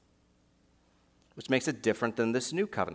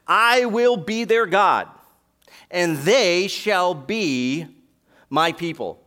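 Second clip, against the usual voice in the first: much louder and higher, drawn out slowly; slow, ringing proclamation; 7 semitones higher, 9 dB louder, much slower than usual. Acted. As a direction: proclaim each word like a herald. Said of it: "Now that last".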